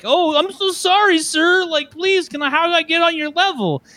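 a high-pitched, mock-humble apology dripping with sarcasm; sarcastic grovelling.

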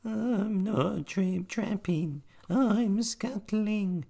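very high, slow squeaky spider; tiny spider voice. A narrator's reading.

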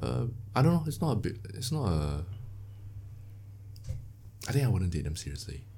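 normal talking voice

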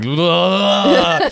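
high put-on grunt; a hammed-up Russian grunt; mock grunt. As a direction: grunt like the big Russian man. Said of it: "big Russian man is going".

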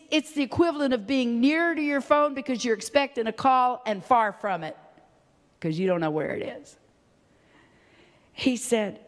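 ordinary talk

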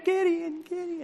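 模仿回声，越来越轻; 把名字像山谷回声一样渐渐送远; fading echo. Acted. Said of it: "And then it echoes".

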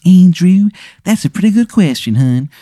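put-on high, hammy Baltimore accent; terrible Baltimore accent. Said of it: "his terrible accent".

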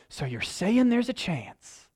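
slow, mock-hopeful "So you're saying there's a chance?"; lightly borrowed comedy movie line; playful nod to a famous film line; movie quote. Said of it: "they look at each other and they're like".

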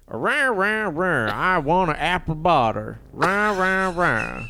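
high, drawn-out caricature American twang; mock American accent. Imitating a Frenchman's impression of Americans, hammed-up American accent. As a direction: stretch the r's into an exaggerated American honk. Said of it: "he said that we sounded like".

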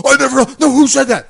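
indignant denial. Retold or imitated. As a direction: play the accused guy, outraged and loud. Retold phone fight with promoter.

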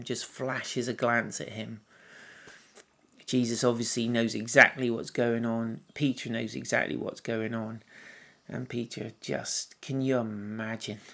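usual speaking voice

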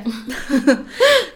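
loud burst of laughter. Real, not acted. Host laughs at the actor ruining his job, loud laugh.

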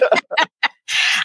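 high breathy laugh. Real, not acted. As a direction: burst out laughing. Host laughs at own story.

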